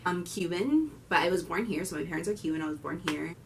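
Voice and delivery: normal talking voice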